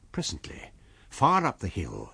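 ordinary delivery, nothing marked

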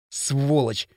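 angry muttered outburst; exasperated, not shouted; heated curse. A narrator's reading. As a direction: let the insult out in frustration. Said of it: "в сердцах выпалил Кирилл".

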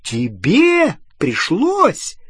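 slow, high-pitched incredulous question; drawn-out indignant high swoops. A narrator's reading. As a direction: squeak it in outraged disbelief. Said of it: "Голос у Гермионы всё повышался".

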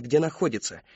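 usual speaking voice

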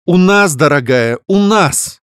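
high, loud and cold; icy. Acted. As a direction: freeze her with it. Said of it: "Голос сестры изменился ... утратив всю мягкость". (ледяным тоном)

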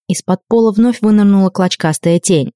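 plain reading voice

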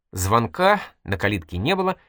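ordinary delivery, nothing marked

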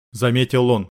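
ordinary delivery

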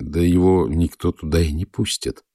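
usual speaking voice

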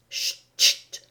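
urgent voiceless hush; frightened shushing. A narrator's reading. Terrified rabbit hushes Alice, urgent shushing. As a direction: shush her in sudden alarm. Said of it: "fit le lapin soudain épouvanté".

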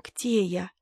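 faint breathless whisper. A narrator's reading. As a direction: barely voice it, just waking. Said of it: "Елена Сергеевна зашептала".

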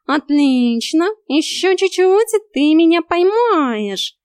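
high, loud, stretched mock encouragement; gleeful sing-out of a goading coach; taunting cat. A narrator's reading. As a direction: egg them on teasingly. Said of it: "издевался он".

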